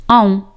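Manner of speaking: "An" said as a nasal vowel with no s sound; French "an", no final s; silent s. Shown as a demonstration. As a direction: drop the S in "An". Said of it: "la letra S no se pronuncia".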